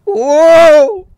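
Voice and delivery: one huge high belted wow. Shown as a demonstration. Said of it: "mon imitation de [name]".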